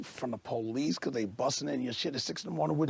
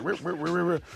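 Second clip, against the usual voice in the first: quick repeated scratch noises, pitched up; imitated record scratching; 4 semitones higher than usual. Complainer mimicking a DJ scratching, higher repeated rips. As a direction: mimic a needle scratched back and forth. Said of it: "I've seen people go".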